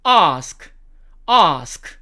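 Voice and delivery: British "ask" with long "ah"; slow British demo of "ask"; British accent. Shown as a demonstration. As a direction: say "ahsk" slowly, the British way. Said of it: "영국식".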